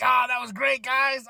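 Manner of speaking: high, hammed-up enthusiasm; acted congratulation. Acts Chad congratulating team.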